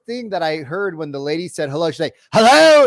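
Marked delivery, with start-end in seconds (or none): 2.3-2.9 loud, high imitation of an aggressive woman answering; super aggressive. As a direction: fire it off as a hostile phone greeting. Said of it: "she was super aggressive".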